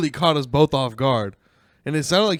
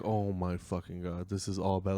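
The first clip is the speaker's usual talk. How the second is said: muttered dread; 7 semitones lower, 12 dB quieter than usual. Retold or imitated. Muttered panic while out of breath.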